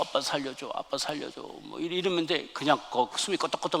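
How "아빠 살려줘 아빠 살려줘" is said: faint, much softer retold plea; understated begging, barely projected; dying child's plea. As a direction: imitate a child weakly begging for help. Poisoned son begging his father.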